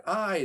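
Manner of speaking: "I" punched high and loud; emphatic I. Shown as a demonstration. Preacher to congregation, pointing at the word "I" with a punch. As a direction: lift and hit the single word "I". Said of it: "is emphatic".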